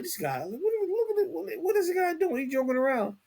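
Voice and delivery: high, puzzled voice as if he's just clowning around; mock baffled onlooker. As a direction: act out your own confused reaction in a high falsetto. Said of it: "I was like".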